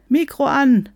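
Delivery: high, loud prompt; urgent demand. Acted. Eager participants alert the muted speaker, urgent. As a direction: call it out impatiently. Chat members demand sound.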